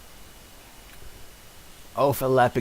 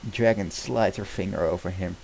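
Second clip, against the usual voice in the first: ordinary delivery, nothing marked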